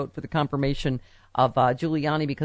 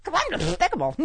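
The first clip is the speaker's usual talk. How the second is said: lightly voiced lisping cartoon duck; Daffy Duck voice; 8 semitones higher, 3 dB quieter than usual. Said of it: "Daffy Duck impression".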